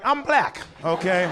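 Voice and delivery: indignant. Acted. Indignant reply.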